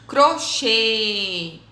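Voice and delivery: crochê with a long final CHÊ; stressed syllable stretched. Shown as a demonstration. Teacher modelling circumflex words, crochê with CHÊ drawn out. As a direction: hold the closed ê ending crochê. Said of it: "a sílaba mais forte".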